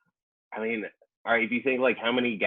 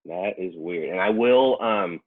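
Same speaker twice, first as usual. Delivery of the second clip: usual speaking voice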